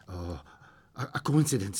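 soft, low hesitant stammer. A narrator's reading. Old man explains away first wish, stammering. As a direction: falter quietly over the first words. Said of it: "stammered the old man".

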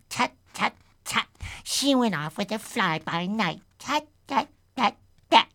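high, tutting creature voice; fussy high-pitched muttering; muttering spider. Acted. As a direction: tut away like a disapproving spider.

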